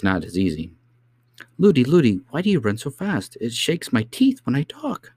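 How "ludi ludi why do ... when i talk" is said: put-on high-pitched little girl's complaint; child's voice, high and pestering; chattering princess. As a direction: chatter high, as if jolted by running. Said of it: "She kept on chattering".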